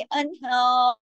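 अनहार held long, closing without a final vowel; stopped ending. Shown as a demonstration. Teacher shows pausing on the word, अनहार with a vowelless ending. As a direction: lengthen the aa of अनहार and stop on r. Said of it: "की पेश को रोक देंगे".

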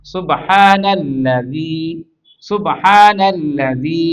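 very slow, long held vowels; drawn-out recitation drill. Shown as a demonstration. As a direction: recite সুবহানাল্লাযী slowly for learners to copy. Teacher models the joined word slowly.

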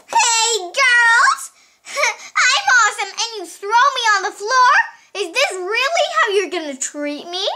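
high-pitched toy's protest; mock indignation. Toy complains about being thrown.

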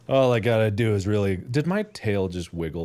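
ordinary delivery

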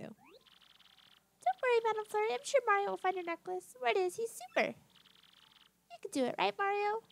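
eager little creature. Acted. Little Punio assuring Madam Flurry about Mario, high eager voice. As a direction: cheer them on in a high little voice.

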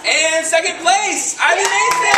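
high excited announcement; announcer shout. Real, not acted. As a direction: call the winners out to the room. Announcing poetry slam winners.

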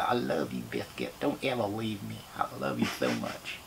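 soft doting dog talk; talking tenderly to his dog, softly. Pretend. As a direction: murmur lovingly to Biscuit. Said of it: "so I talk to my dog like that".

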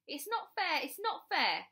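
high, loud, repeated complaint; tantrum protest. A narrator's reading. Bradley fleeing to his bedroom, protesting loudly. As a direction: wail the unfairness like a cross child. Tantrum before slamming the door.

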